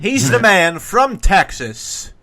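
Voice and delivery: slow, punched-up guest build-up; big drawn-out MC delivery; announcer introduction. Acted. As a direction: announce him like a prizefighter.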